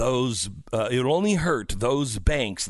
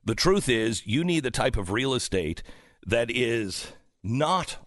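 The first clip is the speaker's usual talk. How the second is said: low-pitched, measured host endorsement; radio ad read; 4 semitones lower than usual. Real, not acted. Host pitching his own real estate agent company, radio ad read. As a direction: sell it confidentially in a lowered voice.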